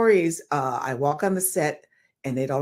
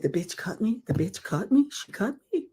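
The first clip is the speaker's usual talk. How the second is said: disbelieving softer echo, rising; incredulous; 4 dB quieter than usual. Retold or imitated. Actor hearing from agent she was cut, stunned disbelief.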